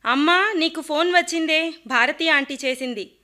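son shouts up to mum, pitched high; a boy yelling that mum has a call. Acted. Son calling mother to the phone, loud and high. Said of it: "అంటూ అరుస్తూనే".

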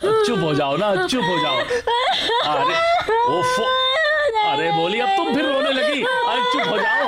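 put-on husband coaxing the sobbing maid in a high baby voice; cooing baby talk. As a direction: soothe her like a crying toddler.

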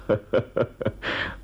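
short, breath-only rueful chuckle; bitter laugh. Acted. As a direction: laugh softly at the self-deception. Bitter laugh at self-deception.

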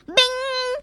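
high, ringing springy "bing"; imitated bounce. A narrator's reading. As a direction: sound the bounce like a little bell. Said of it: "He bounced up into the air".

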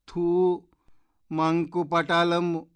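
high, loud, drawn-out scornful outburst; loud exclamation. A narrator's reading. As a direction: say it out loud, slowly and high-pitched. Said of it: "ಪಾಪಚ್ಚಿ ಗಟ್ಟಿಯಾಗಿ ಹೇಳಿದಳು".

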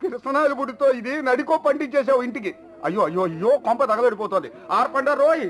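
high, frantic cries over the fire; panicked shouting. Acted. Man shouts to Singamma as the house catches fire, in high frantic panic. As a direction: yell frantically as the house burns.

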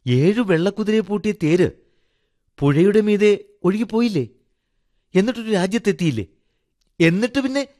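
put-on high, eager child's voice; high-pitched childlike questions, rising eagerly; curious little boy. A narrator's reading. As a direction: prompt the storyteller like an excited small boy. Boy prompts father to continue story.

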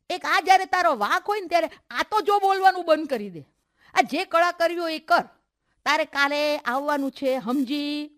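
high, loud bossy scolding. Acted. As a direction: tell a friend off and lay down orders. Friend scolds and orders her along. (ઊંચા, મોટા અવાજે ધમકાવીને હુકમ)

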